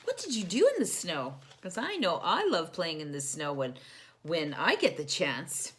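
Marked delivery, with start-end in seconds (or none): none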